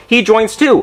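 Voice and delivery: ordinary talk, nothing marked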